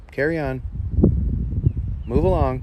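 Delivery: loud order to move along; firm loud shoo. Real, not acted. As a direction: call it out loud to shoo the snake. Shooing a snake away.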